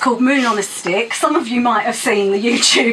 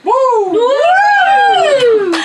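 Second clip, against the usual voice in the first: high, loud whooping; excited whoop; 14 semitones higher, 6 dB louder than usual. Real, not acted. Poet whooping mid-rant to hype the crowd, high and loud. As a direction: whoop to fire up the room.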